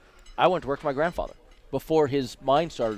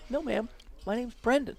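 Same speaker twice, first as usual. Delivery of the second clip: high, careful gentle correction; young dealer telling an elderly player his real name, higher and gentle; politely correcting; 11 semitones higher, 4 dB quieter than usual. Retold or imitated. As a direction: let her down softly. Correcting the confused old lady.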